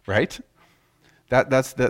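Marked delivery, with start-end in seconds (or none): none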